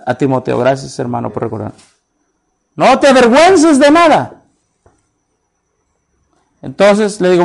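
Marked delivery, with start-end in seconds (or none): 2.8-4.4 proclamación fuerte. Acted. Cita bíblica proclamada.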